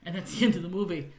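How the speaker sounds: ordinary talk, nothing marked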